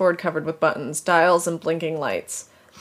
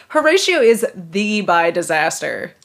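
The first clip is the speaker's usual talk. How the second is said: slow, weighing "bi disaster" word by word; stress on bi disaster; 5 dB louder, slower than usual. Shown as a demonstration. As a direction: lean on "the bi disaster", drawn out. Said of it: "He's not a disaster bi".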